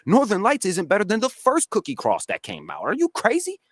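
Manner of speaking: high-pitched, disbelieving rebuttal; incredulous. Real, not acted. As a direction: throw it back in disbelief, voice climbing sharply at the end. Incredulous rebuttal of breeder's claim.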